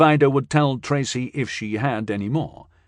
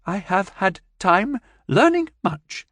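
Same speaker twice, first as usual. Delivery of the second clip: lightly voiced alien; 9 semitones higher than usual. Alien speaks.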